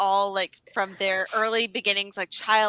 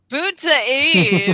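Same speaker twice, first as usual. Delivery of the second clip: slow, loud, regal grande dame announcement; imperious plummy grande dame; 4 semitones higher, 8 dB louder, much slower than usual. Retold or imitated. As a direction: proclaim it like a stately duchess. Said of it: "and let us not forget, my dear friends".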